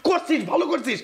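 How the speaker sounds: high-pitched, incredulous rant; worked-up, exasperated challenge; exasperated scolding. Acted. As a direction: scold your brother, pitch up. Elder brother scolds brother over marriage.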